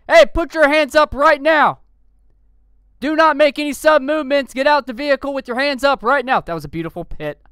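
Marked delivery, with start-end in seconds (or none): 0.1-6.4 loud, high police orders; shouted commands. Acted. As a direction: bark orders at the suspect. Officer commanding suspect.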